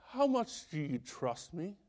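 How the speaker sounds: "How much do you trust me?" said softly and gently; quiet voice of God. Retold or imitated. God's reply, first take.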